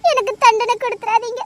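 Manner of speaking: ordinary delivery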